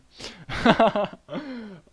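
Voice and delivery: high, loud peal of laughter; real laugh. Real, not acted. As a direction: burst out laughing at yourself. Laughs at own boast.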